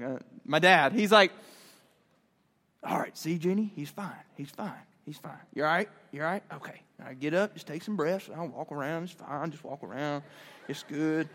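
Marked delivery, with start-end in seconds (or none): 2.8-11.3 low, very soft country mumble; calm dad. Retold or imitated. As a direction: imitate an unfazed father reassuring everyone. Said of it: "he's pretty calm under pressure".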